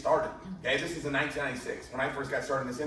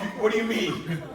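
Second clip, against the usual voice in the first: mock-stunned question, pitched up; retold bewilderment; 5 semitones higher than usual. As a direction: play up the disbelief for laughs. Stunned on learning he is first recruit.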